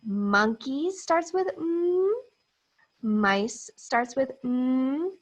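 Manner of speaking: slow, clearly spoken; slow teaching. Shown as a demonstration. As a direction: spell it out for little learners. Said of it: "start with the mm sound".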